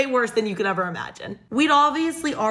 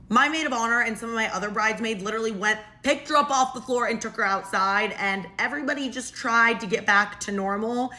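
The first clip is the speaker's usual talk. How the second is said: ordinary talk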